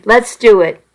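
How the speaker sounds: ordinary delivery, nothing marked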